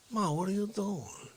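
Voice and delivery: Dick Facce. Acted. Son questioning his mother at Christmas, subdued character voice. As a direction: question Ma in a brash character voice, held down. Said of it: "That's what Facce does".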